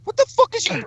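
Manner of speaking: very high exasperated comeback; mock-outraged high retort; retold angry retort. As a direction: fire back, pitch shooting up. Retold argument, his retort.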